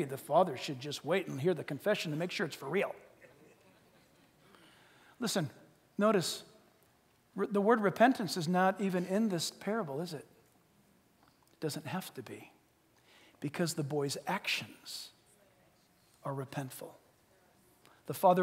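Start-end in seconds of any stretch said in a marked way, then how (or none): none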